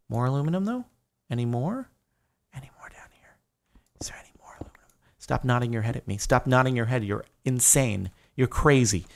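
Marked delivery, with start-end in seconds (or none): 2.5-4.9 hushed, breathy search whisper. Real, not acted. Streamer sneaking through the cave, whispering as he looks for ore. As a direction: lean in and whisper to himself.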